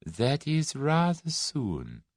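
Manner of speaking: drawn out very slowly, weighing each word; slow, considering. A narrator's reading. Russian leader weighing the date, slowly and thoughtfully. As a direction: mull it over aloud. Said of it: "The Russian seemed to consider".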